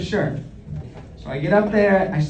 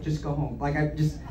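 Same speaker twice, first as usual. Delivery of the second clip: much softer, lower coach voice sending him home; quiet brush-off; 4 semitones lower, 10 dB quieter than usual. Retold or imitated. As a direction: let him down quietly and low.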